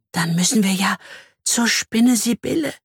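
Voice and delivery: high, anxious half-whisper; fearful whisper. Acted. Bee must visit the spider, whispering anxiously. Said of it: "flüstert sie ängstlich".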